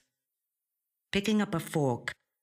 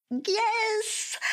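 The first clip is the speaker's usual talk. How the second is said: triumphant yes. A narrator's reading. Winning the online auction bid.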